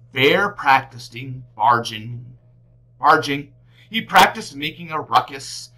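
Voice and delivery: ordinary delivery, nothing marked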